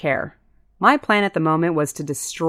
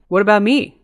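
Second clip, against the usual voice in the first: talking through a mouthful; words round a bite of food; mouth full; 5 semitones higher, 6 dB louder than usual. Acted. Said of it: "asked through a mouthful of raw hamburger".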